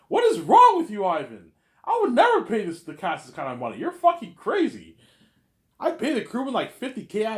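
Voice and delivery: high loud exasperated boss; loud, high outraged scolding; angry rant. Acted. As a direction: yell at him in disbelief.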